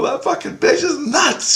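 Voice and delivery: very high, worked-up re-enacted shout; retold yelling. [name] yells at Grace about the boss, retold shout. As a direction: protest in a high-pitched yell. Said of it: "I'm yelling at Grace".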